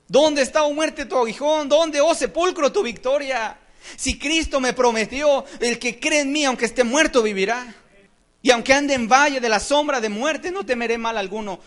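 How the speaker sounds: high, loud declaiming of scripture; triumphant preaching. Real, not acted. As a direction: proclaim it defiantly at full force.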